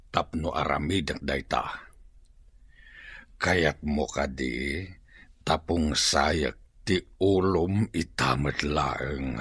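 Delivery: slow, soft threatening voice; quiet menace. Acted. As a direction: lean in and let the threat sink in slowly. Villain threatens the captive chief.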